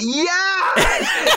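a thrilled, laughing yes; excited yes. Retold or imitated. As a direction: leap at good news with a laugh. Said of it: "I was like".

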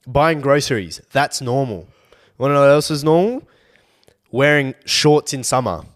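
loud emphatic rant. Real, not acted. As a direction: hammer each example. Ranting about what's normal.

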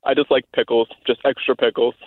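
male voice. Shown as a demonstration. Woman ordering extra pickles at the drive-thru, male voice. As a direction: order like a low-voiced dude. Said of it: "So I hear the man voice".

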